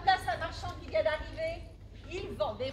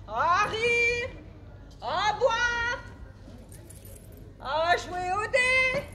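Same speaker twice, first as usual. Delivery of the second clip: indignant build; 5 semitones higher, 5 dB louder, much slower than usual. Acted. Friend mocks husband's revelry.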